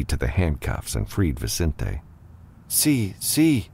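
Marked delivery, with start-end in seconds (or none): 2.7-3.7 dazed reply. A narrator's reading. Dazed man answers in shock.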